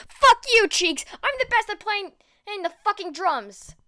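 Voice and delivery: high, loud furious scream; raging high yell; enraged yelling. Acted. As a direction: scream in wounded pride. Bakugo enraged by taunt.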